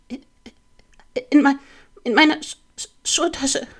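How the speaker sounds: high-pitched, halting stutter bursting out; tormented stammer. A narrator's reading. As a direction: stammer in anguish, forcing out each word high after gaps. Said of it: "flüsterte Patrick gequält". (gequält gestottert, hoch gepresst)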